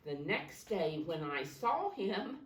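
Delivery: slow storybook reading voice; reading to children. Acted. Teacher starting the picture book for children, slow reading. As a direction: read it slowly for the class.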